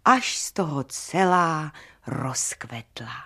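usual speaking voice

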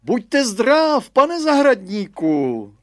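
high, loud, slow headmaster greeting; hammed-up schoolmaster calling out. A narrator's reading. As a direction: call out across the fence as a pompous old headmaster.

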